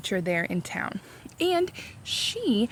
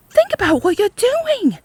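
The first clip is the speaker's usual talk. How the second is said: high, loud desperate plea; crying out; 12 semitones higher, 7 dB louder than usual. A narrator's reading. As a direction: cry out to stop the mob. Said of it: "cried Miss Catherine".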